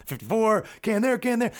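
high mock play call; football play call. Imitating a leader calling plays at the protest. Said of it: "like a football quarterback".

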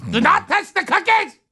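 very high, mock-stern German accent; German granny. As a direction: scold like a cartoon German grandmother. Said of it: "If your grandmother speaks like a German".

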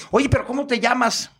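high, put-on questioning voice; imitated performer. Transvestite performer asks the caller's name, high camp voice. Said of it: "al último me dice".

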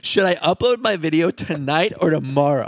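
lightly voiced high influencer. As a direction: mimic a needy influencer. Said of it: "someone posts an Instagram story".

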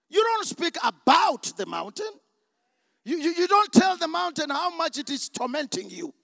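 loud, impassioned preacher's challenge; full-force preaching. Real, not acted. Preacher rebukes doubters in the congregation, at full force. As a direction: drive it home to the whole congregation.

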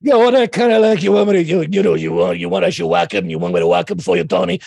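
rough, mumbled New York Italian tough guy. Shown as a demonstration. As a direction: mumble it like a Brooklyn mob heavy. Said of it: "like a New York Italian guy".

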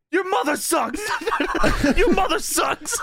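high, laughing mock yell back at a fan. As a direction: snap back at the heckler, hammed up. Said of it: "he looks at them and he says".

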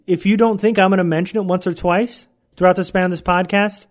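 ordinary talk, nothing marked

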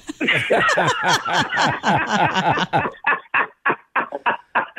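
long helpless cackling laugh. Real, not acted. Caller cracks up at his own joke, long laugh. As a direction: laugh on and on, tailing off.

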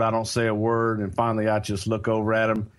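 ordinary talk

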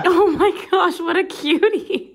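high, laughing coo at a cute story; laughing aww. Real, not acted. Host hearing about the toddler, laughing high coo.

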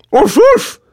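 very high, loud little-dog yaps; Lobzik barking. A narrator's reading. Family dog puts in his two cents, high barking. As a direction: bark like a small excited dog. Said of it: "Lobzik barked".

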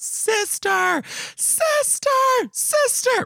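put-on high, drawn-out childish call. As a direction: call out sister across the block, long and high. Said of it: "call out".

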